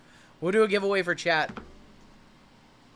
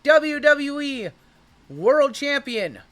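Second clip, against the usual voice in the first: hyped reveal; 7 semitones higher, 5 dB louder than usual. Real, not acted. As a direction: draw the reveal out for the buyer. Hyped reveal, teasing the buyer.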